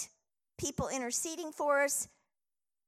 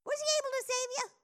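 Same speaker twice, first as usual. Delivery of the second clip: much higher and faster, like a worried king calling into the den; anxious high call; 11 semitones higher, much faster than usual. Retold or imitated. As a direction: squeak it out, fast and pleading.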